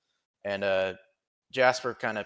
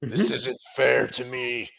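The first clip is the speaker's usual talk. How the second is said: whiny; 3 semitones higher, 10 dB louder than usual. Acted. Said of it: "He's so mad. He's hurt".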